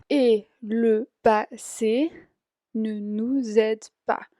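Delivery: nous aide con z de enlace, "nuzede"; liaison en nous aide. Shown as a demonstration. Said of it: "yo hago nous aide, hago z". (nous aide dicho "nu-zed", con liaison)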